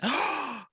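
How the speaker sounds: high, excited gasp; sharp intake of breath; sudden gasp. Real, not acted. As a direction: gasp mid-thought. Sudden reaction while planning.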